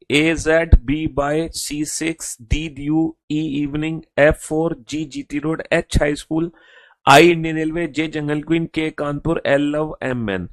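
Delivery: slow, deliberate recitation of each letter and word; slow read-aloud demo. Shown as a demonstration. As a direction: read it out slowly for students to repeat. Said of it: "फिर से जोर से बोल के पढ़ के दिखाता हूं".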